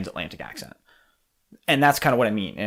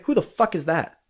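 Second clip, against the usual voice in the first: natural reaction; 6 semitones higher than usual. Retold or imitated. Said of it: "how about you say".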